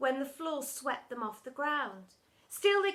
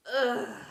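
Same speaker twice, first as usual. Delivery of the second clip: loud disgusted groan; 5 dB louder than usual. A narrator's reading. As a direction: groan like a bored school. The whole school groaned.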